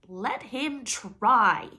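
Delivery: very slow, loud, through clenched teeth; menacing warning. Acted. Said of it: "he warned, smoke pouring out between clenched teeth".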